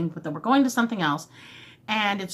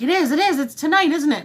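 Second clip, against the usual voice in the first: eager, a touch higher and louder; excited; 5 semitones higher, 4 dB louder than usual. Retold or imitated. Boyfriend guessing the event, excitedly upbeat. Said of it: "he's like".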